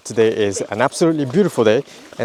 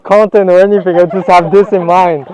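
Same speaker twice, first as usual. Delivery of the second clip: high, loud and breathless with relief; excited relief; 7 semitones higher, 5 dB louder than usual. Real, not acted. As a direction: gush out the nerves and laugh. Relief right after proposing.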